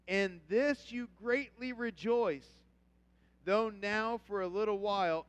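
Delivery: loud, slow, rising pulpit preaching. Acted. Pastor thundering the verse to his congregation, loud and slow. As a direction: build the scripture to full preaching force.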